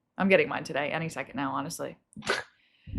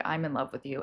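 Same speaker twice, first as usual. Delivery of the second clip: soft confession; 7 semitones lower, 9 dB quieter, faster than usual. Retold or imitated. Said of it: "be like".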